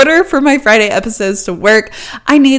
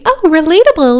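higher, louder fake-chirpy mockery; mock cringy relatable; 8 semitones higher, 4 dB louder than usual. Mocking cringy relatable talk to podcast listeners, chirpy and fake. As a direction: sneer it like a cringy influencer. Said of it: "not in a cringy".

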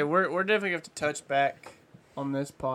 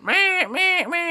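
high nasal mallard quacks; duck call honks; 4 dB louder, much slower than usual. Retold or imitated. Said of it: "I've heard you do that countless times".

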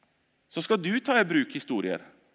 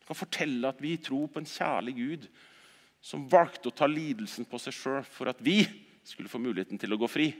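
ordinary talk

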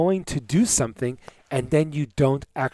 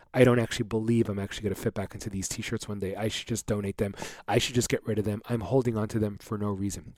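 softer, lower resigned self-talk; quiet resignation; 4 semitones lower, 7 dB quieter than usual. Retold or imitated. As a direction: mutter it to yourself, defeated. Resigned inner thoughts.

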